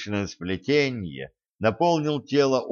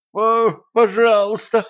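desperate plea; 11 semitones higher, 5 dB louder than usual. Acted. Victim begging attacker.

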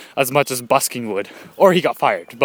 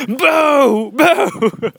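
booing; 9 semitones higher, 4 dB louder than usual. Pretend. Mock booing the bad busker.

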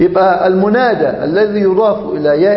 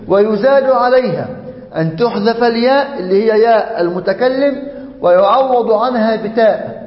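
ordinary delivery

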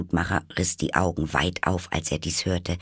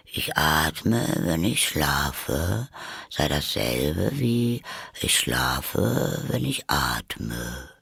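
very slow, stretched, drowsy Dormouse; talking in sleep; 2 semitones lower, much slower than usual. A narrator's reading. Dormouse joins the argument asleep, drowsy. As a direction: let each phrase swell sleepily and drift. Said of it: "das im Schlafe zu sprechen schien".

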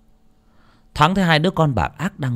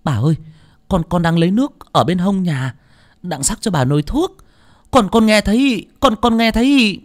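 put-on high-pitched boy, rising into louder alarm; panting young boy; 10 semitones higher, 5 dB louder than usual. A narrator's reading. Servant boy running to the old lady with news, panting. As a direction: blurt it out in stammered starts. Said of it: "Thằng bé dừng mấy giây thở dốc".

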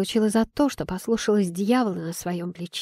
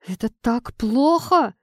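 through gritted teeth; 4 semitones higher, 3 dB louder, slower than usual. Acted. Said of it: "сквозь стиснутые зубы".

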